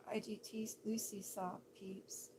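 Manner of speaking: breathy whispered aside; hushed whisper to the camera. Real, not acted. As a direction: whisper it like a secret. Whispered aside about viewer.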